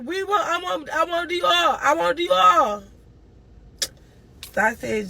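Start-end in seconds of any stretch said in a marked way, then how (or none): 0.0-2.8 put-on high child's voice insisting; kid insisting. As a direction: whine it like a little boy who wants his dog back. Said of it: "He's like".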